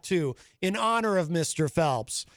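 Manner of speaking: usual speaking voice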